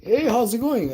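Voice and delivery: high, loud friendly hello; high stranger greeting. Retold or imitated. Stranger greeting the streamer, high chirpy voice. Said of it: "it's like".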